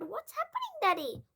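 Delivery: put-on a frightened child calling to her father; crying out as a girl. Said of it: "she cried".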